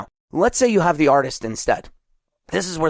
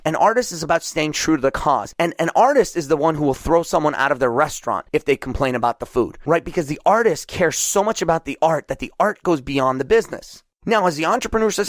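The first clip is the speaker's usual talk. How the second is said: normal talking voice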